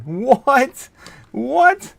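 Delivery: high, loud incredulous "what?"; stunned, loud double-take. Real, not acted. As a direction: shoot up high in disbelief. Incredulous reaction to chat message.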